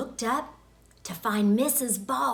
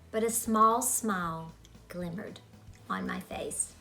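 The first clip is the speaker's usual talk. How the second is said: ordinary delivery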